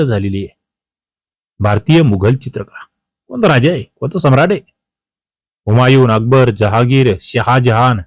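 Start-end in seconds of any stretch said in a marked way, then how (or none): none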